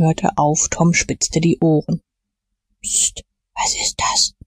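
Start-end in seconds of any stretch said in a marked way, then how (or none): 2.8-4.3 hushed, slow whisper. A narrator's reading. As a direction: breathe it out without voice, scared of being heard. Boys hiding, hearing a noise. (ängstlich geflüstert, ganz ohne Stimme)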